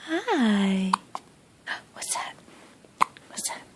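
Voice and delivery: whisper. Real, not acted. Whispering to baby.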